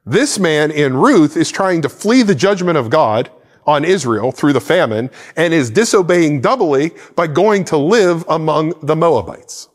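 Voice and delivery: ordinary delivery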